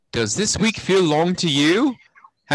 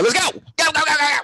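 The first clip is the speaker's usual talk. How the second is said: excited shout; 13 semitones higher, much faster than usual. Pretend. Teacher hypes the weather.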